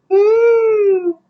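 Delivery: very high, sustained "woo". Real, not acted. Reader reacting after a retake, high sustained woo. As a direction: let out a long, thin, high-pitched woo. Exclaims while re-recording.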